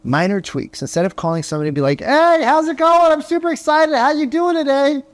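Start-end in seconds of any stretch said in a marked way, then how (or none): 2.0-5.0 fake-peppy cold call greeting, pushed high; overexcited. Shown as a demonstration. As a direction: salesman overdoing the enthusiasm on a call. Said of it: "that's really awkward".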